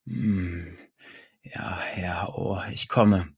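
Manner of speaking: soft, slow acted moans, partly whispered; hushed moaning, drawn out at the end. A narrator's reading. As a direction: let the words dissolve into quiet moans. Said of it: "stöhnte Susanne".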